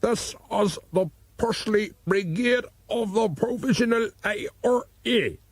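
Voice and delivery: slow, put-on Irish accent, menacing caller; mock Irish accent. A narrator's reading. Lockhart threatens O'Brien by phone in a put-on Irish accent. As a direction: fake Irish terrorist on the phone. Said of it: "in a supposedly Irish voice".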